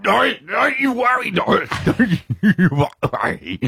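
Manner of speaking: high, strained mock retching through the words; retching while talking. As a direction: choke out reassurance while fighting the urge to vomit. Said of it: "'cause I'm going".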